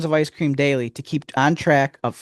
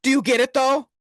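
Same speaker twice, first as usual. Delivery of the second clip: insistent; 9 semitones higher, faster than usual. Acted. Pushing his pun on partner.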